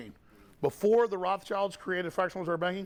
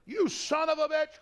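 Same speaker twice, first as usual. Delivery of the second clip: higher, louder yelled curse; shouted insult; 13 semitones higher, 5 dB louder, slower than usual. Retold or imitated. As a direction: roar the insult from a car. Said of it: "they just go".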